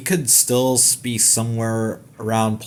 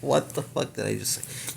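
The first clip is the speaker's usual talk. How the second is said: muttered aside; 8 dB quieter than usual. Real, not acted. Amused at own slip.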